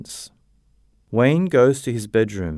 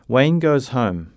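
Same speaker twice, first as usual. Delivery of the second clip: ordinary delivery, nothing marked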